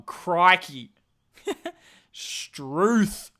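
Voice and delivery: put-on a jokey Australian accent with a laugh; mock Aussie exclamations. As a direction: throw out Australian slang like a caricature. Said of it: "do an Aussie accent all day".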